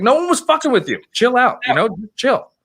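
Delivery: mock yelling at him to chill out; reliving the shout at the guy; retold yelling. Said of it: "I was like".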